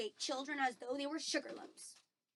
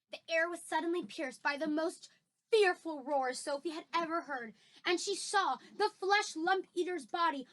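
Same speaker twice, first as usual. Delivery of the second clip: excited storyteller, punching the violent words; dramatic story narration; 5 semitones higher, 6 dB louder than usual. A narrator's reading. Reader describes the giant's wild thrashing, dramatic story narration. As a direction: build the action louder and higher, then settle.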